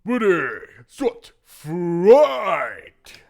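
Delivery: loud, high fight-announcer call. Shown as a demonstration. Player showing off announcer voice for the fight, booming. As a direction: boom it out, stretching FIGHT. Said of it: "I could do the announcer voice".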